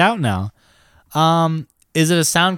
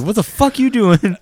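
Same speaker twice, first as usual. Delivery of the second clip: angry dad voice; 7 semitones higher than usual. Retold or imitated. Voicing the dad in a story.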